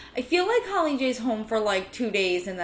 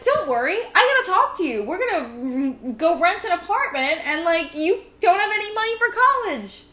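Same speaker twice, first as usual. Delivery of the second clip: cheery mom voice; 8 semitones higher, 4 dB louder than usual. Retold or imitated. Mom breezily breaks bad news.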